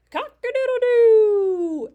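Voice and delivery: loud, high crow with a long last note; rooster crow. Acted. Reader shows a child what roosters say, full loud crow.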